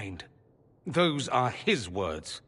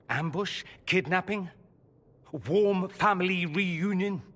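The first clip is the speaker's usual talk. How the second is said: rising sarcastic questions; mock each guess, building up; 6 semitones higher than usual.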